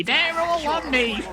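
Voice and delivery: high, slow squeaky Elmo-like voice; Oz impression. Caster mimicking Oz calling for help, high and slow. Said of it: "Sorry, that was my Oz impression".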